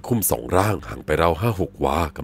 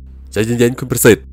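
raised, slow nervous call; mildly shaken, louder appeal; trembling plea; 6 semitones higher, 7 dB louder, slower than usual. A narrator's reading. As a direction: urge him to stop, rattled. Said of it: "ร้องห้ามเสียงสั่นรัวๆ".

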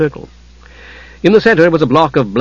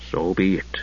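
lower, softer grim verdict; 7 semitones lower, 10 dB quieter than usual. A narrator's reading. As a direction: say it darkly, final. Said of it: "his tone grim".

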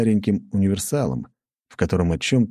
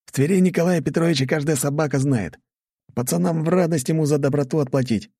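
put-on young guard voice; 10 semitones higher than usual. A narrator's reading.

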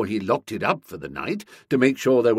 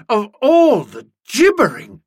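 high, loud, indignant outburst; sputtering outrage; 10 semitones higher, 9 dB louder than usual. A narrator's reading. Nobby berates Boko over his interruptions, outraged. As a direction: burst out, appalled at his idiocy.

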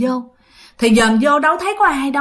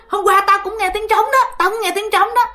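mock exclamation, pitched higher; excited blurt; 8 semitones higher than usual. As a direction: jump in eagerly, voice up high. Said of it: "ông Út cũng la lên liền". (reo lên hào hứng, giọng cao)

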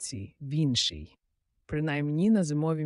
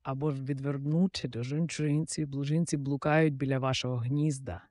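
usual speaking voice

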